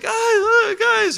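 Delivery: high, loud shout after the team; calling out. Retold or imitated. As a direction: yell to get the team to look back.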